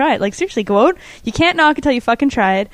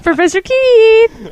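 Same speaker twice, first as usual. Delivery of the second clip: high, slow and louder mock announcement; playful call-out; 11 semitones higher, 5 dB louder, much slower than usual. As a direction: bellow his title like a game show host. Calling out to co-host playfully.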